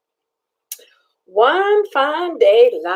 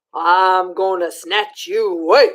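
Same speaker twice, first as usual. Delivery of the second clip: haunt's threat; 7 semitones lower than usual. Acted. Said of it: "that swamp haunt moaned".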